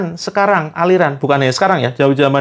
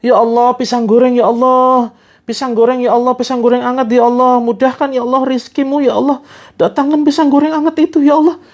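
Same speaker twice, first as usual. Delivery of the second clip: high, pleading, half-cried prayer; mock tearful prayer; 7 semitones higher than usual. Jabar prays for fried bananas until he cries, mock weeping. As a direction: beg God like a crying child, hammed-up. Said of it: "sampai nangis tuh".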